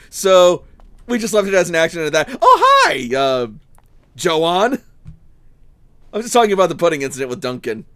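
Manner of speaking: ordinary talk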